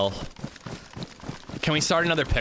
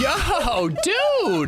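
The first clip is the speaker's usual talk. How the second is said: excited shout; 13 semitones higher, 3 dB louder than usual. Real, not acted. Reacting to teammate's play.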